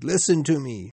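desperate plea. Acted. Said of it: "he cried".

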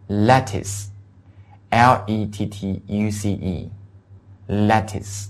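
letters spelled out very slowly; slow spelling demo. Shown as a demonstration. Teacher spells lettuce out slowly for students. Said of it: "叫lettuce".

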